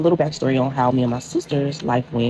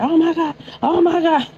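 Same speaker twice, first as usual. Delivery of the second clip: much higher, louder, retold wailing cry; family wailing; 3 dB louder than usual. Relatives hearing of the death, much higher and louder mock wailing. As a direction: cry it out like hearing terrible news.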